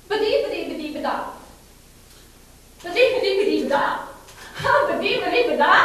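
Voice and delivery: high, quick babble in a made-up language; Arlene's special language. Retold or imitated. Imitating a resident's private language to an audience, high loud babble. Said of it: "And it went sort of like this".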